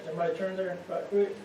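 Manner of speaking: ordinary delivery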